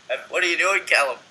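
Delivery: high, loud startled question; surprised call. Real, not acted. Catching Callum doing something odd, surprised call. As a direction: catch him in the act.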